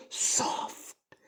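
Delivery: slow, soft whisper of soft. Shown as a demonstration. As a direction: breathe soft out slowly in a whisper. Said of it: "बोलो कैसी".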